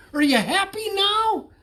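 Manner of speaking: high, loud hopeful question; eager call. A narrator's reading. Bullfrog calling to the captain, high and eager. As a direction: call it through the door, hopeful. Calling through cabin door.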